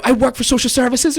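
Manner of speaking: high, mock-screeched boast of authority; imitated screamer. Woman claims authority over the teller, high mock screech. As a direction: mimic her shrieking her credentials. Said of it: "she's screaming at me".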